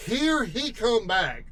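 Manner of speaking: ordinary talk, nothing marked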